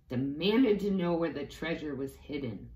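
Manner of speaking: ordinary delivery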